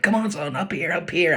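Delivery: very high coaxing call; calling the puppy up. Acted. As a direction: lure the dog onto the bed. Patting bed, calling puppy.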